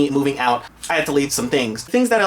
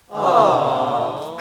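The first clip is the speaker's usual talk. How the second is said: long, drawn-out sentimental "aww"; exaggerated swooning aww; mock cooing aww. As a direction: coo like a touched audience. Cooing over his own story.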